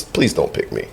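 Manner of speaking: soft breathy plea; whispering. Retold or imitated. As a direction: whisper it like a secret. Joke plea said softly.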